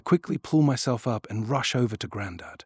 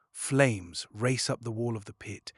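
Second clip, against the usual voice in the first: ordinary delivery, nothing marked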